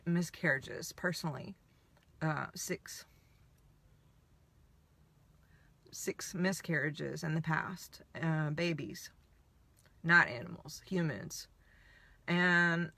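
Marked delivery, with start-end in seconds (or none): 0.1-11.5 real sadness, softly spoken with long pauses; subdued grief. Real, not acted. Sharing her own pregnancy losses, subdued and halting. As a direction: let the words drop away quietly, haltingly.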